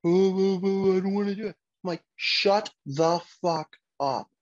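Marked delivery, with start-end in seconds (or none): 0.1-1.6 higher put-on kid's whine; mock whining. Father imitating his kids complaining, mock whine. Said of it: "hear you whine about shit". 2.1-4.3 slow, word-by-word retort; emphatic retort. Retold or imitated. Father answering his whining kids, slow emphatic retort.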